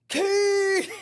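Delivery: high, drawn-out imitated yell; yelling a name. Woman coming down to the cells in chains, yelling a name. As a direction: holler it down a long hallway. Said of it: "yelling my name".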